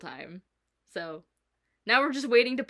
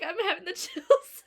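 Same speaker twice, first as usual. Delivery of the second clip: higher, breaking into a laugh mid-line; laughing through it; 10 semitones higher, 4 dB louder than usual. Retold or imitated. Telling her boss about the chills, laughing. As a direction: giggle while admitting the chills. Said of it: "And I'm like".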